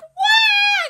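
very high, loud storybook scream; mock scream. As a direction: squeal like a frightened girl. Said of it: "She screamed".

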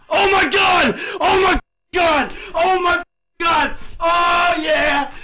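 high, drawn-out cries of disbelief; real jackpot elation; ecstatic shouting. Real, not acted. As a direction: yell it out, overwhelmed by a huge win. Celebrating a huge gambling win.

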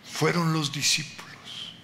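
ordinary talk

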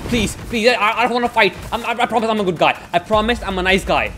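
high, urgent begging; panicked pleading. Real, not acted. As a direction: plead fast not to be attacked. Pleading with an attacker in game.

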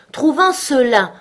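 grumpy, drawn-out reply; sullen. A narrator's reading. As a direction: repeat it pointedly, put out at the interruption. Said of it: "répondit la souris d'un ton plus maussade".

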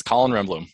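"Renblom" said as the name's owner says it; correct name pronunciation. Shown as a demonstration. Guest corrects host on his last name, showing correct pronunciation. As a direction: pronounce "Renblom" clearly as REN-blom. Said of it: "how you pronounce your last name".

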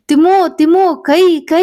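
high, pained cry of a name; pained yell. Acted. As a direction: yelp out in sudden pain, pitch jumping up. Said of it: "ಇದ್ದಕ್ಕಿದ್ದ ಹಾಗೆ ಸ್ವರ ಬದಲಾಯಿಸಿ".

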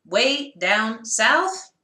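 very slow, each word apart; one clap per word. Shown as a demonstration. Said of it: "The first part is easy".

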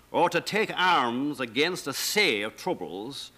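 slow Shakespearean soliloquy in the original accent; Elizabethan Hamlet. Shown as a demonstration. The scholar recites Hamlet as heard in 1601: Elizabethan accent, slow. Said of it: "what they heard in sixteen hundred and one".

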